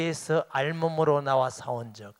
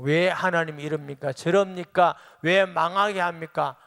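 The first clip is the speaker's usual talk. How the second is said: louder, whining complaint to God; mock complaint; 3 semitones higher, 5 dB louder than usual. Believer loudly complaining to God about losses.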